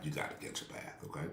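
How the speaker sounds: hushed, almost whispered reminder; whispered aside. Shown as a demonstration. Pastor whispering a reminder to his congregation. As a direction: drop to a whisper and say it.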